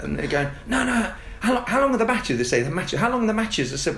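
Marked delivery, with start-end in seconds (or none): 0.7-2.4 put-on much higher, insistent child voice; nagging little kid who won't let it go; high kid voice, mock exasperated; pestering kids. Kids pestering coach about matches. 2.6-3.7 put-on much higher, insistent child voice; nagging little kid who won't let it go; high kid voice, mock exasperated; pestering kids.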